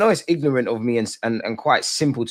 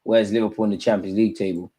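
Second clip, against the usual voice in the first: ordinary talk, nothing marked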